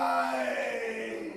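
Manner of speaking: long, high, sung-out wail of why; a hero howling at the rainy sky; anguished hero cry. Acted. Hero cries out to the sky as his girlfriend dies, in an anguished wail. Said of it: "looks up to the sky and goes".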